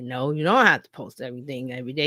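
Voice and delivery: ordinary talk, nothing marked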